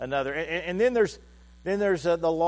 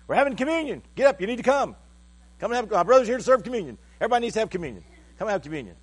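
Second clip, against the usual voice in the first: high, louder retold rallying call; rousting people; 8 semitones higher, 6 dB louder, faster than usual. Sister rounding people up for communion, loud high rousting. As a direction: imitate someone hustling everyone along, pitched up and insistent. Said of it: "she was out rousting people".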